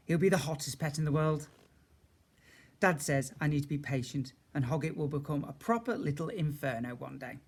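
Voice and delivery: ordinary delivery, nothing marked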